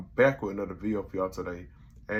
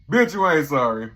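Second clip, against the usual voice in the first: loud, higher snap back; sassy retort; 6 semitones higher, 11 dB louder than usual. Real, not acted. Reviewer snapping back at Oprah's apology, loud. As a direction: call her out with attitude.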